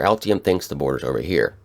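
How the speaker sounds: ordinary talk, nothing marked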